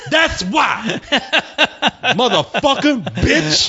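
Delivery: mock shout. Imitating preacher shouting at caller.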